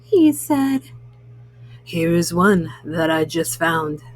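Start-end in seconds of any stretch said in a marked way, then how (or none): 1.8-4.0 low-pitched raccoon character. A narrator's reading. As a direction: play the raccoon well below your usual pitch. Raccoon character speaks in story.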